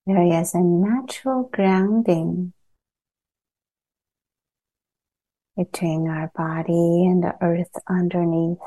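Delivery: calm meditation guide with long pauses between phrases; unhurried, evenly pitched guidance, leaving long silences; guided meditation. Acted. Teacher guiding a group to settle into body and earth, guided meditation.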